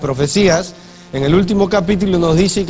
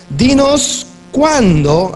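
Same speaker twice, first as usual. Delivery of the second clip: slow emphatic quote; 5 semitones higher, much slower than usual. Real, not acted. Quoting disciples, drawn out.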